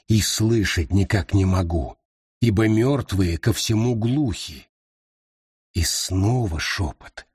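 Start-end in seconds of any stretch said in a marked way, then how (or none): none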